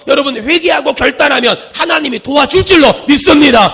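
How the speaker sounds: loud, driving pulpit declamation; full-force preaching. Real, not acted. Preacher urging the congregation to repent, loud fervent preaching. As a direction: hammer out the repeated confession and build to a declaration.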